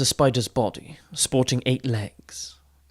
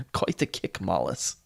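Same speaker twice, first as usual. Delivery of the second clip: soft chuckled words, much softer; chuckling; 6 semitones lower, 7 dB quieter than usual. A narrator's reading. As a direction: laugh it out under the breath. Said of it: "He chuckled".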